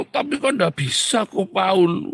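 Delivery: high, loud whine of protest; protesting pastor. Acted. Pastor objecting to his counsellor, mock protest. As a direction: plead that it cannot be done.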